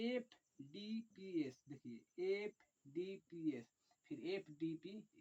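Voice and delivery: slow dictation. Shown as a demonstration. Teacher dictating the order to students, slowly. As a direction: spell it out slowly so they can write. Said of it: "अब क्या लिखेंगे".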